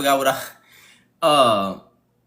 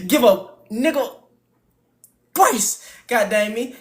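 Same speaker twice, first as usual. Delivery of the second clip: put-on high old man rant; 6 semitones higher, 3 dB louder than usual.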